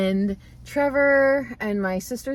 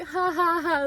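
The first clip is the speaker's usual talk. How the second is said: higher-pitched spoken mock laugh; imitated laugh; 7 semitones higher than usual. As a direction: sound out a fake ha-ha-ha. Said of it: "And they were like".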